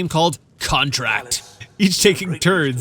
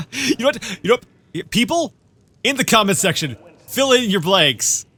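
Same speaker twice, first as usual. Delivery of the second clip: high, louder rallying call; calling out to viewers; 6 semitones higher, 4 dB louder than usual. Real, not acted. Calling on viewers to fill in the blanks, high and louder. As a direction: rouse the audience.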